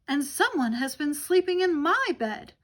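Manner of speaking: middle-sized bear, firm and indignant; medium-sized Mama Bear voice. Acted. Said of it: "Mama Bear exclaimed in her medium-sized voice".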